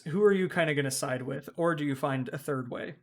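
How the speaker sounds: usual speaking voice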